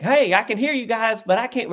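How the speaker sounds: high-pitched Southern caller; down-south uncle. Retold or imitated. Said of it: "You know, down south".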